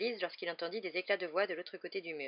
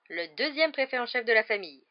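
proud, higher and louder announcement; 4 semitones higher, 8 dB louder than usual. A narrator's reading. Mother showing off her son's new badge, proud. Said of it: "dit-elle avec orgueil".

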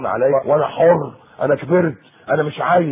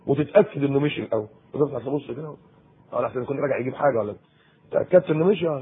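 usual speaking voice

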